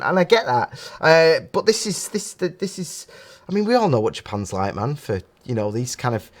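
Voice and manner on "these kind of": ordinary talk, nothing marked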